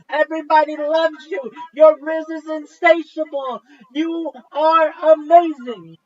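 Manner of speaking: big, high, booming hype shout; hype man. Pretend. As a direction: hype him up like an announcer. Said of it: "I'm your hype man".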